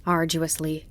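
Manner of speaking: ordinary delivery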